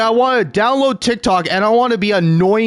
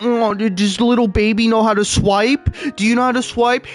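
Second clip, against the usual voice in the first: higher, sugary condescending app voice; mocking TikTok voice; 5 semitones higher than usual. Streamer imitating TikTok babying its users, higher mocking voice. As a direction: talk down to the user like a baby. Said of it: "where it's like".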